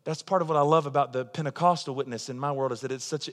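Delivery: normal talking voice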